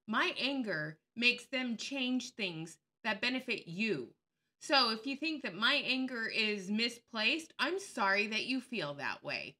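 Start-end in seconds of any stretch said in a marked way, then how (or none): none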